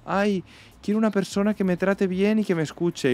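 high, whiny mock voice of people who say the right thing; mocking quote. Mocking what people claim to want in a partner, high and whiny. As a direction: parody the fake-nice answer in a high voice. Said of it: "la peña dice unas cosas".

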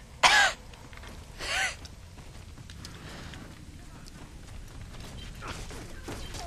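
hacking cough, throat on fire; harsh coughing fit. Real, not acted. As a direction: cough hard from the burn. Spicy food challenge coughing.

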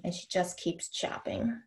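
measured, deliberate poetry recital; reading verse to an audience; poem reading. Acted. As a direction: let each image land as you read. Reads a poem aloud.

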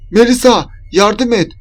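high, loud call for help; cry for help. A narrator's reading. Trapped Berna calling to her friend, crying for help. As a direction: call out urgently to be rescued. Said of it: "diye Berna'nın sesini duymuştum".

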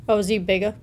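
usual speaking voice

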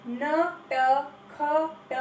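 नटखट drawn out as na-ta-kha-ta; syllable by syllable. Shown as a demonstration. Teacher sounding out नटखट for the class. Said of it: "सही उच्चारण करो".

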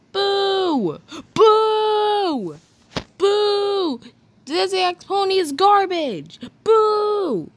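loud, hammed-up ranting and booing; mock angry rant. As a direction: trash the ship like an outraged fan. Ranting against the ship.

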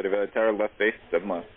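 ordinary delivery

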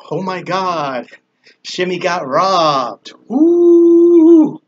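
slow, then a loud high whoop; mock celebration. Joking to viewers about getting robbed, whooping loud and high. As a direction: whoop as if being robbed is a party.

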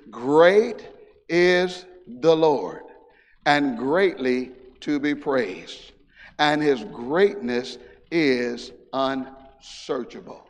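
slow, drawn-out scripture reading; solemn reading. Acted. Preacher reading the psalm slowly to the congregation. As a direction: lean on each word, slowly.

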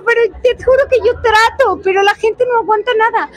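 high exasperated excuse; indignant protest. Retold or imitated. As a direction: protest your innocence with exasperation. Quoting her own protest.

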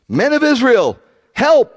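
high-pitched cry for help, acted out; shouting. Accuser rousing the men against Paul, acted shout. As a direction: call out like an alarmed accuser. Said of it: "they shouted".

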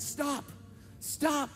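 high-pitched call to halt; re-enacted urgent plea. Trying to halt screaming woman.

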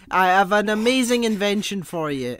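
put-on theatrical Italian-accent proclamation; mock Italian accent. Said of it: "when I go for an Italian".

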